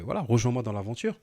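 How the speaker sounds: usual speaking voice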